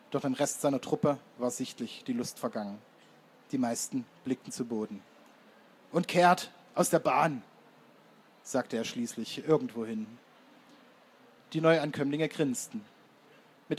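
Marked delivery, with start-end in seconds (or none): 5.9-7.4 slow, loud drill command; marching order. A narrator's reading. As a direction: order the troop off, word by word. Anführer gibt widerwillig Marschbefehl. (langsamer, lauter Marschbefehl)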